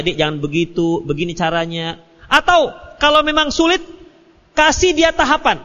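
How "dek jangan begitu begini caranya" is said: softer and lower, patiently guiding; gentle guiding husband. Retold or imitated. Husband gently shows his wife the right way, soft and patient. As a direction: coax her gently, like a kind husband. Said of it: "Arahkan dia".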